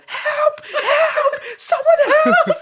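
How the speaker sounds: high-pitched mock wail; panicked cry for help. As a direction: imitate muffled desperate cries from a trapped friend. Retells cries from inside the house.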